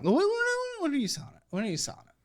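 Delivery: surprised. Real, not acted. Stunned by the car plan.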